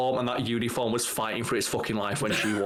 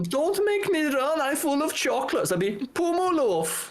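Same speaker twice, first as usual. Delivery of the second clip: very high-pitched German cartoon kid; Uter German accent; 13 semitones higher than usual. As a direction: squeal it out in a childlike German accent. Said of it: "that German kid from The Simpsons accent".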